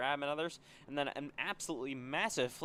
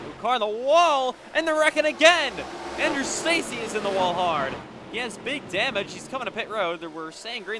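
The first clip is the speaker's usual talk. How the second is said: much higher, much louder urgent play-by-play; excited wreck call; 10 semitones higher, 11 dB louder than usual. Real, not acted. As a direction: shout the new crash as it unfolds. Sudden new wreck during race call.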